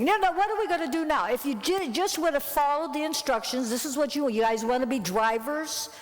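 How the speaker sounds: high, fast exasperated rant; berating the crew, pitched well up; angry scolding. Retold or imitated. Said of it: "I'm just saying".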